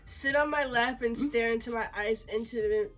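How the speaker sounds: ordinary talk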